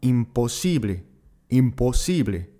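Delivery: "impossible" the Spanish way, im-po-SI-ble; Spanish pronunciation. Shown as a demonstration. Teacher modelling the Spanish word for learners. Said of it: "Le mot impossible se prononcera".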